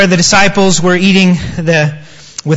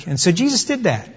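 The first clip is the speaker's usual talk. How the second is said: normal talking voice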